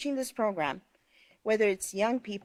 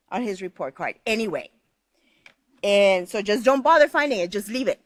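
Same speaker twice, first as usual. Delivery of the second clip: put-on loud, rough scolding of the kids; loud, harsh demo of what not to say; 2 semitones higher, 8 dB louder than usual. Shown as a demonstration. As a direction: bark it like a fed-up parent. Reading the harsh parent response.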